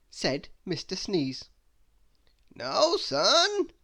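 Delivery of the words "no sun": high, loud astonished wizard cry; a grand wizard aghast at the news; wizard exclaiming. Acted. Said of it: "exclaimed the wizard".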